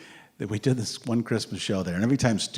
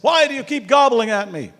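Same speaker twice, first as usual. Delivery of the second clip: much higher and louder, fed up; exasperated shout; 12 semitones higher, 11 dB louder than usual. Retold or imitated. Stuart yelling up at the gobbling woman, an exasperated shout. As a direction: call out the heckler in disbelief.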